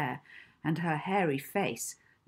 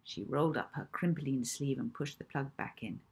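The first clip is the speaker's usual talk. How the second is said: ordinary delivery, nothing marked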